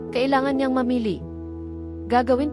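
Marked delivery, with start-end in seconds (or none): none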